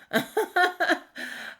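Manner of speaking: high short laugh amid grief; pained laugh. Real, not acted. Daughter telling of her mother's illness, high pained laugh. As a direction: laugh lightly through a painful memory.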